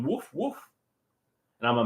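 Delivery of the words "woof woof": mild dog bark. Retold or imitated. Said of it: "And the dog's going".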